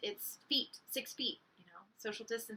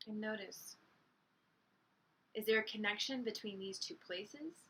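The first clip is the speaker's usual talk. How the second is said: guided meditation; 5 dB quieter than usual. Real, not acted. Teacher guiding listeners to breathe and picture light, guided meditation. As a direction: lead them inward gently, leaving long silences.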